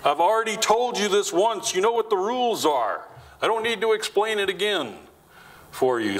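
a parent who has explained it once too often; exasperated parent. Retold or imitated. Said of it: "How many have done that with your children".

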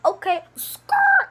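high, loud, drawn-out squeal; inner comeback. Acted. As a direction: squeal the catchphrase, stretching it. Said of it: "inside of you like".

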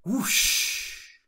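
unvoiced rushing whoosh like big wings; airy whoosh sound effect; breathy whoosh of wings. A narrator's reading. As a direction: blow out a wing-flap whoosh.

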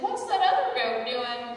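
put-on slow innocent kid question; curious child. Billy asking his mom, slow and innocent. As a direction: ask it wide-eyed like a little boy. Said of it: "And Billy's like".

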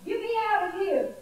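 high-pitched, restrained story yell; acted plea for rescue, pitched well up; yelling for help. A narrator's reading. Clay trapped in the oven, mock yelling for help. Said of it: "I yelled and knocked and pounded".

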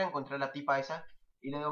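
ordinary talk, nothing marked

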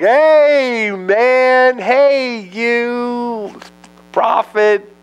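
high, loud, smarmy flattery; buttering up. Retold or imitated. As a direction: schmooze the prophet with a fake grin. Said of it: "he's buttering him up".

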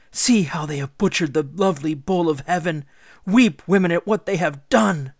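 full-voiced hateful lament; howl of hatred. A narrator's reading. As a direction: wail your hatred out to the crowd. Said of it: "let out a howl of pure hatred".

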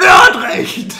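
whisper, then a burst of loud shouting; sudden shout. Acted. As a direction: blurt it out far too loud. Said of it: "das war tatsächlich etwas laut".

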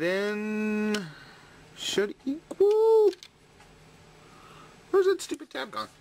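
high, strained, frustrated voice; frustrated squeak. Real, not acted. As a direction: squeak it out in exasperation. Fighting a tab that won't fit.

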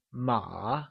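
"ma" softly dipped, then rising; falling-rising tone. Shown as a demonstration. Teacher gives the correct answer, "ma" in falling-rising tone. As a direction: let "ma" fall low and curl back up. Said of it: "falling rising tone".

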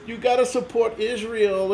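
high, slow mocking sing-song; mocking whiny nag. Ranting vlogger mimics flag-wavers in a slow high mocking voice. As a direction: mimic the preachy crowd with scorn.

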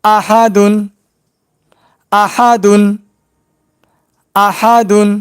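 আহাদুন said smoothly as one word; joined word; joined reading. Shown as a demonstration. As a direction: blend আ হা দুন together. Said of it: "এবার একসঙ্গে উচ্চারণ করব".

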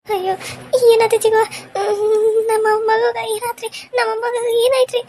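high, anxious questioning; alarmed pleading. Acted. Grandmother asking what happened to the baby, alarmed.

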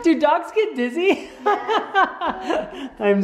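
high giggly question; laughing. Real, not acted. Laughing while playing with dog.

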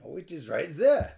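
put-on French accent, a bit loud; emphatic fake French accent; weird French voice. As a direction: insist like a mock Frenchman. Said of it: "you get to talk in a weird voice".

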